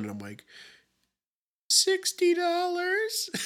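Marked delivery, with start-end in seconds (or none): 1.7-3.3 very high, strained, drawn-out squeak; choked out. Retold or imitated. Flustered shopper answers saleswoman about phone cost, choked out. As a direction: squeeze it out as if choking, slowly. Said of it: "I choke out that".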